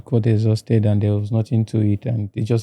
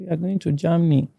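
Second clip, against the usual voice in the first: higher-pitched imitated reply; retold answer; 7 semitones higher, 4 dB quieter than usual. Migrants tell the hosts their destination, higher retold reply. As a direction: answer up high, lightly.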